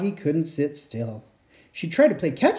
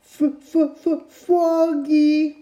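put-on high, louder stuttered baby wail; 11 semitones higher, 4 dB louder, much slower than usual. Baby Polly wailing for her big brother, high stutter. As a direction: sob out her brother's name. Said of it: "wailed Polly".

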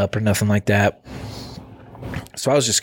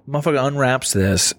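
ordinary talk, nothing marked

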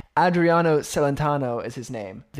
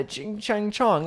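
fake Chinese; 8 semitones higher, much slower than usual. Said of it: "You can do, like, fake Chinese".

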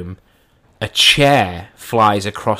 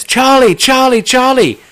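high, loud yell down the stairs; re-enacted high-pitched holler; panicked shout; 7 dB louder than usual. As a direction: call urgently for help from upstairs. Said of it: "I shout down the stairs".

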